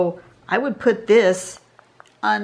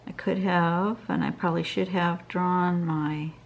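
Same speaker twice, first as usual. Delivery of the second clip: normal talking voice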